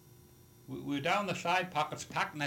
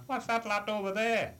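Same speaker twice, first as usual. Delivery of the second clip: high, louder call across the deck; retold shout; 9 semitones higher, 4 dB louder than usual. Sailor shouting to his mate on deck, loud high call. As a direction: call out over the fog to a shipmate. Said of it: "I shouted to the other man".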